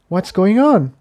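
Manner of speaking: a hint of a worried filly; young pony Tiptoe. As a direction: ask it as a curious young mare. Tiptoe's line in story.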